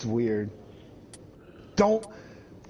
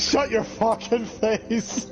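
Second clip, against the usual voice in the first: slow, higher, laughing line; laughing mock threat; 6 semitones higher, slower than usual. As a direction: drag it out while laughing. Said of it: "repeating the mock line".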